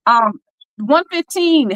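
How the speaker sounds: usual speaking voice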